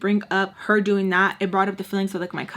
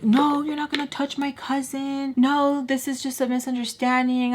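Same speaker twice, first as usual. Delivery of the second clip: mock his protest in a higher voice; mocking boy; 5 semitones higher than usual. Boy defending his cousin, mockingly imitated. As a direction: imitate him pleading, pitched up.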